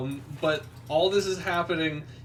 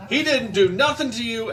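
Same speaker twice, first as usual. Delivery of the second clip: higher, louder protesting yell, half acted; mock group outcry; 6 semitones higher, 6 dB louder than usual. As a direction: shout it out in outrage for your brother. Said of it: "they all go".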